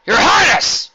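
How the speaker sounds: loud high shout. A narrator's reading. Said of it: "the general shouted".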